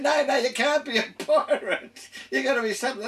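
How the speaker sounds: amused mock teacher refusing the boy's pirate dream; laughing retelling. School staff refusing his pirate dream.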